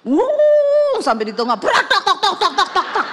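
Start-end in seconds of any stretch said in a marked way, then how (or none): 0.1-1.0 high, loud sustained "wuh"; imitated engine roar. Wife mimics the car climbing the mall ramp, imitated roar. 1.6-3.1 high, rapid-fire "tok-tok" rattle; imitated mechanical clatter. Wife mimics the car failing mid-ramp, imitated clattering. Imitates exhaust falling off midway.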